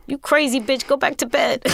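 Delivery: cousin's brush-off, retold while laughing; laughing while quoting. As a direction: giggle out his rude brush-off. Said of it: "And he said".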